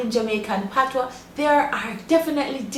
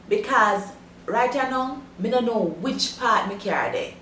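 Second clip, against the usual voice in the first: broad Jamaican patois storytelling. Shown as a demonstration. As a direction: tell it in straight Jamaican patois. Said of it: "listen to a straight patois version".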